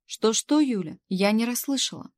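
loud, pointed question from a boss; sharp query. A narrator's reading. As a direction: call out as if you missed a mumble. Boss did not catch the mutter.

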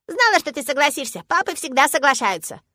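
put-on high, loud childish glee; triumphant little girl. A narrator's reading. Girl after father agrees, triumphant. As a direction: crow like a child who won.

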